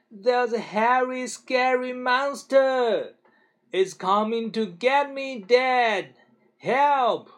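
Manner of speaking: high, loud fake-frightened cry; mock panic. A narrator's reading. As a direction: pretend terror to fool dad. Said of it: "he yelled".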